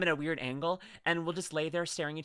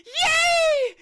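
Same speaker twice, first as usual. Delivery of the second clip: much higher, fast, loud squealing joy; delighted squeal; 10 dB louder than usual. Real, not acted. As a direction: squeal with sudden delight, then a shouted yay. Learns dogs like baby voice.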